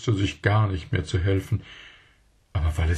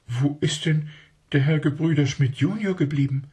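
put-on puzzled child; 9 semitones higher than usual. A narrator's reading. Puzzled child asks uncle.

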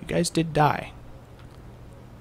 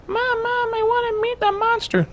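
put-on much higher, eager child voice; a kid begging mom to meet the monster; excited little kid. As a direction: beg like a small kid. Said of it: "It's like".

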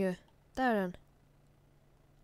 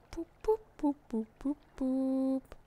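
cute footstep noises; 3 semitones higher, slower than usual. Pretend. Imitating tiny duck footsteps.

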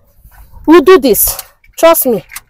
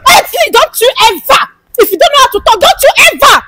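high, heated warning; angry warning; 7 semitones higher than usual. Acted. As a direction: warn them off, pitch raised. Acted quarrel, warning her off.

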